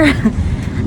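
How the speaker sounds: short unvoiced chuckle; quick airy laugh. Real, not acted. Laugh during rainy selfie update.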